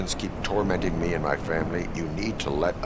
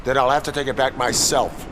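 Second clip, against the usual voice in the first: loud threat; 10 semitones higher, 6 dB louder than usual. Acted. Robot threatening to take badge.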